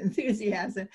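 ordinary talk

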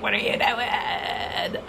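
slow, hushed scoffing ego; hushed mock ego voice; inner ego voice. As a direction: let the ego ask it in disbelief, drawn out. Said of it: "my ego is like".

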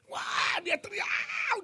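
high drawn-out mock howl; imitated yell. Preacher mimics the possessed youth suddenly going wild, imitated yell. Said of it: "dia teriak, saudara".

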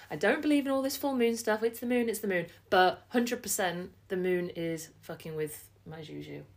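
ordinary talk

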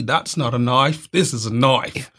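Crocodile Dundee Australian impression; movie-quote Australian twang; Australian accent. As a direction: put on a slow Aussie Crocodile Dundee accent. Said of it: "That's a good accent".

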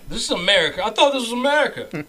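loud, high indignant protest; mock outrage. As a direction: protest loudly as if freedom is under attack. Mock outrage about banned flavors.

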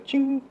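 high ringing "ching"; quick high sound effect; imitated ching. Preacher voicing blinkers coming off, high ching.

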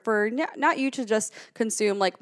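ordinary talk, nothing marked

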